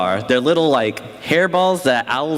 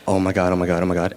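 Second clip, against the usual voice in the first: low, muttered mock panic; a low string of pretend dread; 8 semitones lower, much faster than usual. Boy retelling his panic before the substitute, low dread. Panicking as teacher comes over.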